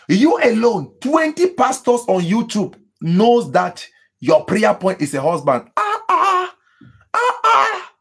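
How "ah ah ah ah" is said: high, drawn-out mocking "ah, ah"; incredulous ah ah. Real, not acted. Pastor scoffing at the congregation with a high "ah, ah". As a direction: exclaim in disbelief, pitched high.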